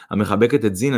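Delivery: plain reading voice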